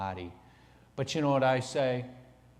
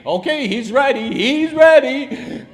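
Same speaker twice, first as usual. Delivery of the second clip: high, loud, gloating and laughing; mocking high-pitched gloat; gleeful excuse voice; 12 semitones higher, 15 dB louder than usual. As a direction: cackle like the excuses have caught him.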